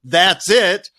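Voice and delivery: let-down protest, punched out; dissatisfied. Shown as a demonstration. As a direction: say it as if cheated. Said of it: "switch your consciousness from".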